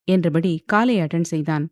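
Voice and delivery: ordinary delivery, nothing marked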